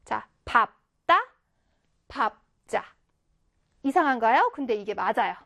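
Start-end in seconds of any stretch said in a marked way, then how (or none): 0.4-2.8 밥 따, 밥 자, each syllable separated and lowered; model pronunciation. Shown as a demonstration. Teacher repeating the correct readings, model pronunciation of 밥 따, 밥 자. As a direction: drill 밥 따 then 밥 자 with tense second syllables. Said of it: "이렇게 읽어줍니다".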